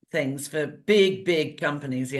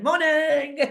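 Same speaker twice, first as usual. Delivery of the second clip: bright, higher sing-song hello; cheery morning greeting; 6 semitones higher, 3 dB louder than usual. Retold or imitated. Mum arriving at the office, cheery higher greeting. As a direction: breeze into the office all chirpy. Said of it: "goes into an office ... going".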